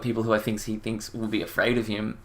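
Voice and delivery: ordinary talk, nothing marked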